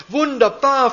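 high, loud happy little duke; delighted. Acted. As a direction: cheer like a little hop. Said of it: "freut sich der Großherzog".

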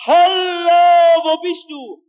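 loud, high drawn-out hallo; searchers bellowing. Retold or imitated. Searchers calling to a man lost on the prairie, bellowing. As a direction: call far across open land. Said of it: "mit denen sie brüllten".

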